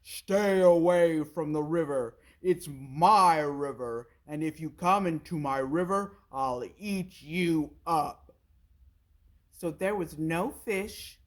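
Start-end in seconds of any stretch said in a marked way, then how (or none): 0.0-8.2 big crocodile roaring at the forest creatures; crocodile shouting. A narrator's reading. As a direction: bellow it like a selfish river bully. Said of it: "he shouted to the creatures".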